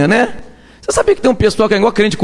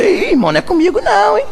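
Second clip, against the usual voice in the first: dismissive mock believer; 12 semitones higher than usual. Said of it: "Agora quando fala".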